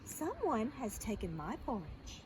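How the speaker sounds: low, soft medium-sized voice; Mama Bear. Acted. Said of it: "in her medium-sized voice".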